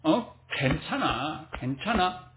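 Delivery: 낮고 작은 목소리로 달래듯; 괜찮다고 스스로를 토닥이듯 나직하게; 자기를 다독임. Retold or imitated. 숨막힌 자신을 다독이는 말. (나직이 다독이는 말투)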